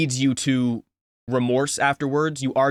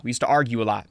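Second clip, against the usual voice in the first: ordinary delivery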